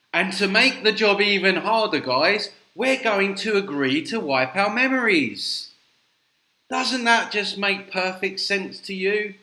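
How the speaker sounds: loud, high-pitched heavy sarcasm; ironic and sarcastic. Acted. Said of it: "I'm being ironic and sarcastic".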